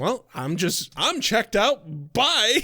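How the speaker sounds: mock-cheerful sign-off, climbing high on "Bye"; breezy checking out. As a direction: toss it off and bail out, pitch rising to the end. Acting out checking out under stress.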